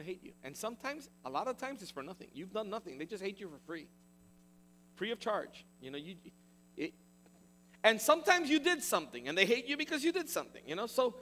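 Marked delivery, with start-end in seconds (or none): none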